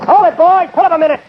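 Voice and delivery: loud, high call to halt the riders. Acted. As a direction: shout the order across the posse. Marshal halts riders on horseback.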